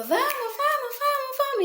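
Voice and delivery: high-pitched rushed urging; impatient hurrying. Retold or imitated. Mother rushing son out the door, impatient high urging. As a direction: hurry the child along, fed up.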